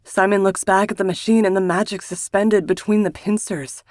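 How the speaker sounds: ordinary delivery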